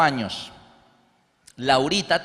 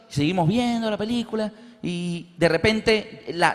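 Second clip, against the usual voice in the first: usual speaking voice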